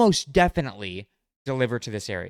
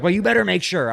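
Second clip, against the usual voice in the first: mom's insistence; 6 semitones higher, 8 dB louder than usual. Retold or imitated. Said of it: "She's like".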